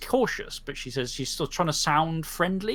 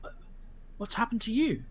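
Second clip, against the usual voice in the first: gentle question; 7 semitones higher, 5 dB quieter than usual. Acted. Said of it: "she's like".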